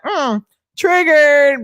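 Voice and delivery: slow, drawn-out sing-song taunt; mocking taunt. Mocking the angry man, teasing him as triggered. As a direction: tease him, stretching the word out. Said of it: "I was like".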